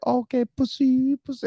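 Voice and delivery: high, slow teasing jeer; sing-song mocking of the sober guys; mock taunt. Drunk wives taunting husbands, high mocking jeer. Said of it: "so they were like".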